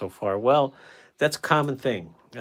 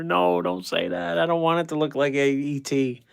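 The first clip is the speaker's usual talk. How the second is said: dismayed protest; 9 semitones higher, 5 dB louder than usual. Real, not acted. Painter objecting to a viewer's comparison, dismayed protest. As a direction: protest loudly, dragging out the first words.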